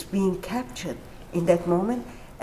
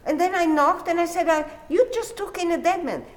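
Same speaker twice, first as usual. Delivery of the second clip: high agitated telling; 9 semitones higher than usual. Retold or imitated. Retelling terror scene, pitch high.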